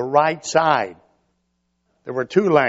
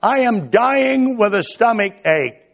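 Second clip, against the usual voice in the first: higher and louder, a mock whimpering plea; pleading baby voice; 8 semitones higher, 4 dB louder than usual. Preacher voicing the baby faking a stomach ache, mock desperate plea. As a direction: play the baby overacting its pain for mom. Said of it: "the baby is saying".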